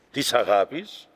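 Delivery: normal talking voice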